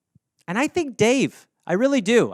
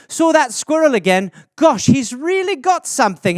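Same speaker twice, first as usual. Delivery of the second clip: higher, cheery mock-mom voice; imitating mom's posts; 6 semitones higher, 4 dB louder than usual. Mother posting online, in a mock cheery higher voice. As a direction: play a sweetly chatty mother. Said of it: "It would just be that all day".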